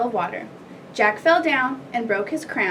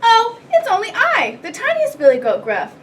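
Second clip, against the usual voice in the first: high-pitched tiniest billy goat; tiny goat squeaking innocently; very small voice; 11 semitones higher, 6 dB louder than usual. A narrator's reading. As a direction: pipe up in a little high voice. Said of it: "in his very small voice".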